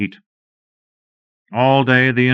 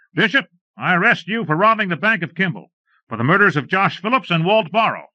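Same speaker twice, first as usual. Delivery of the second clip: higher, firm arrest declared to the outlaws; steady lawman voice, pitched up; stern lawman; 9 semitones higher than usual. Acted.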